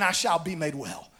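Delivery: low, softened declaration of faith; quiet assurance. Retold or imitated. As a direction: drop low and soft on the quoted words. Quiet retelling of her new words.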